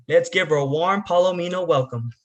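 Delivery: ordinary talk, nothing marked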